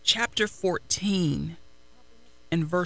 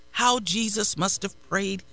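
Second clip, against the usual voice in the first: normal talking voice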